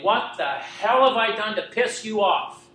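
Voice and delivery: loud, slow, high anguished yell; a furious cry to God; screaming at the stars. Retold or imitated. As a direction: hurl it at the sky, full voice. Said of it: "screaming the top of my lungs".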